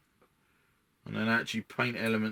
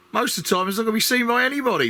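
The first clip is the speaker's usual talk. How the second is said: excited; 10 semitones higher, 6 dB louder, faster than usual. Real, not acted. Excited rush about hidden detail.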